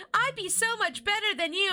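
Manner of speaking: put-on high girlish bragging; mock boast. Streamer imitating Chris boasting to sister, high mock brag. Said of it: "she's like".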